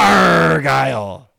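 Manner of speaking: slow, loud growling Arrr-gyle pirate. Acted. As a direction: drag out Argyle like a pirate. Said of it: "I'll give you the full pirate voice".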